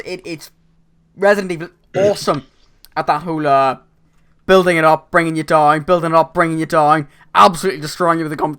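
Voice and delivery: usual speaking voice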